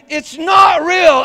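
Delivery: high, loud, slow scream. Retold or imitated. Father screaming to his daughter, high slow shout. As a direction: shout it so she believes. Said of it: "I screamed".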